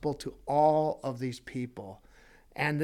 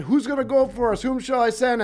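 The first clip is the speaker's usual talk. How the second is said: raised, quick voice of God calling out; God's call; 7 semitones higher, 6 dB louder, faster than usual. Acted. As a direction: call out like God seeking a volunteer. God asks Isaiah who goes.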